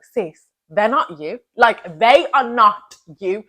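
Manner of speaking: usual speaking voice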